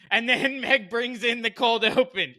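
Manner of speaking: laughing through the words, high and slow; laughing while telling. Real, not acted. Host laughing as he recalls Meg's cold open. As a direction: let the laugh break into the story.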